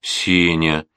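plain reading voice

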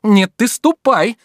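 loud, high, slow insistence; insistent. A narrator's reading. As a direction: press the order slowly and forcefully. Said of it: "настойчиво говорил Обломов". (настойчиво, громко, с нажимом)

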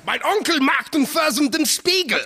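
German accent. Shown as a demonstration. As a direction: show them how German really sounds. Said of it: "German goes like this".

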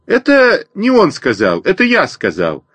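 put-on high chirping sparrow boy; chirping bird person. A narrator's reading. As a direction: chirp it out bravely. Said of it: "чирикнул я".

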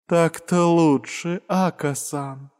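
slow, breathy, languid murmur; languid whisper. Acted. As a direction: purr it slowly and seductively. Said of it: "томно прошептала она". (томный медленный шёпот)

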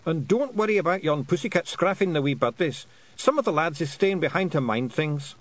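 high, lilting Scots wee-man voice; a tiny Scots chief, earnestly explaining; Feegle voice. Acted. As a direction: Rob Anybody explaining to Tiffany, high Scots voice. Feegles lift Tiffany.

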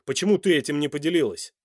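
loud, insistent reproach; demanding. A narrator's reading. Man asks his lover why she hid it, demanding. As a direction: press her for a reason. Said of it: "требовательно спросил Данте".

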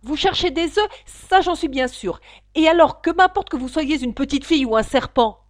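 high, loud, sharp questioning; suspicious pigeon. Acted. Pigeon interrogating Alice, high and suspicious. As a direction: demand it like a distrustful bird.

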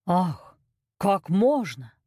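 mildly horrified cook; a touch of aghast protest; horrified exclamation. A narrator's reading. Cook recoils at the queen's order, understated horror. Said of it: "вскричал повар в ужасе".

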